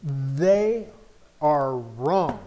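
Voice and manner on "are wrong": ordinary talk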